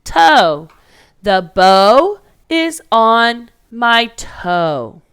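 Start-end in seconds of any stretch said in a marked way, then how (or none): 1.2-5.0 "The bow is on my toe." spoken slowly, word by word; slow dictation. Shown as a demonstration. Teacher dictating the sentence to kids, slow and spaced. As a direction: space out every word so kids can write along. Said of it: "Let's sound everything out".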